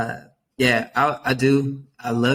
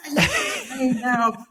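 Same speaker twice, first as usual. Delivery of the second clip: over-the-top celebration; 12 semitones higher than usual. Retold or imitated. Cheering on a resistant student for writing his name, hyped shout. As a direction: make a huge deal of a tiny win. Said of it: "I made a huge big deal".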